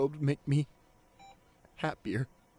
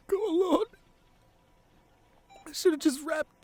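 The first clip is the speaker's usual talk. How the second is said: guilt breaking through; 7 semitones higher than usual. Acted. Partner confessing regret to an unconscious love, straining with guilt. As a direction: let the guilt crack the voice open. Guilt welling into tears at bedside.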